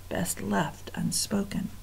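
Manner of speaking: hushed, breathy whisper of dread; unvoiced spooky hush. A narrator's reading. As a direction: breathe it like a secret.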